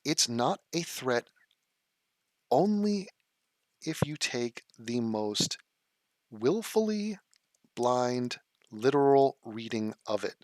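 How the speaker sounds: slow, spaced-out sarcastic explanation; slowly spelling it out to a chatter; slow deliberate scorn. Real, not acted. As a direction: lay it out word by word with contempt. Slow building rant at chatter.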